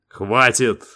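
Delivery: clipped command to stop; curt cut-off. A narrator's reading. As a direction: shut them down, firm and final. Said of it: "отрезал Дэйв".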